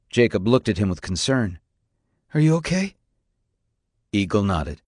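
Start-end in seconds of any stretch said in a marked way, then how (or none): 2.3-2.9 put-on higher-pitched young voice; concerned boy. A narrator's reading. Jacob checks on his father after the cuffs, high concern. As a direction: ask it high, as a worried son.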